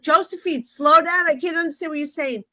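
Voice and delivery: high-pitched retold appeal; exasperated plea. Retold plea to agitated neighbor.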